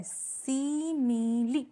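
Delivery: "सीमिली" drawn out slowly in three syllables, see-mee-lee. Shown as a demonstration. Teacher shows students the sounded final e, "सीमिली" slowly split in three. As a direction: stretch each part of "सीमिली", ending on a clear ee. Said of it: "यहाँ ये sound ई का दे रहा है".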